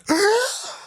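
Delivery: high, drawn-in mock gasp; shocked gasp. Imitating a reaction YouTuber at the reveal, shocked gasp. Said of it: "she's, like, shocked".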